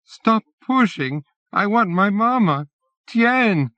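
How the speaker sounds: put-on a terrified little boy calling for his mother; crying piteously. A narrator's reading. Child crying for his mama and sister in the chaos. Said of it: "cries piteously".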